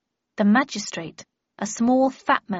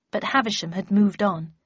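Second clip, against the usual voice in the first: usual speaking voice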